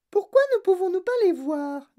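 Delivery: very high, put-on little girl's voice; Veruca Salt demanding. A narrator's reading. Veruca to Wonka, wanting to see the cows, demanding. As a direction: demand it in a tiny high-pitched voice. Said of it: "demanda Veruca Salt".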